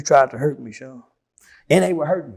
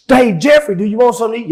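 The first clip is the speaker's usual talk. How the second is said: friendly cop; 5 semitones higher, 7 dB louder, faster than usual. Retold or imitated. Police voiced in interrogation story.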